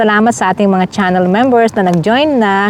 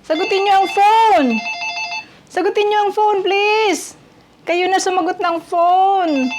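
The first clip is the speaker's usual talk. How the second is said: high-pitched, hammed-up panic; mock phone panic; 10 semitones higher, 4 dB quieter than usual. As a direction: plead in comic dread for someone else to pick up. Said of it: "nagtuturoan kami kung sinong sasagot ng phone". (kunwaring natataranta, mataas ang boses)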